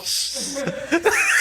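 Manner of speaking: cracking up, high and bright; high burst of laughter. Real, not acted. Laughing at the absurd chase.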